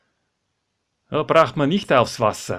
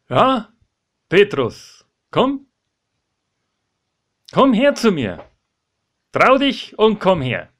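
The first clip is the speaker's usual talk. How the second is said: high, raised beckoning; encouraging call; 7 semitones higher, 5 dB louder than usual. Acted. Jesus calls Petrus to step out onto the water, high encouraging call. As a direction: call him over, urging him on.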